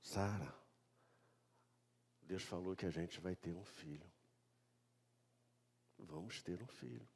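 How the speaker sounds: low, very soft acted murmur; imitating a husband confiding in his wife; hushed confiding. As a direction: break the news quietly, almost under the breath. Abraão breaks the news to Sara.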